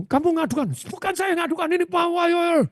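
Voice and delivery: high, soft put-on denial; high mock accusation and denial; retold denial. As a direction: deny it lightly in a high voice. Retold exchange quoted.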